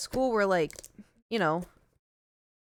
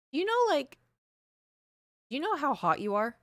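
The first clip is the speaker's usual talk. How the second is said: pitched-up imitation of the friend, playful; high teasing compliment; 6 semitones higher than usual. Friend telling the narrator they're hot, high and playful. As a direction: ask it like it's obvious gossip.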